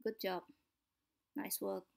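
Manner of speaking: low, soft and flat, no life in it; bored monotone praise; lazy flat delivery. Shown as a demonstration. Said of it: "Nah, terlihat malas, bukan?".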